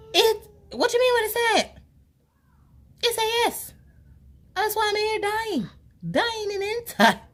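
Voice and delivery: very high, wailing and half-crying; hammed-up wail of despair; mock wailing. Telling auntie it said yes, high mock wail.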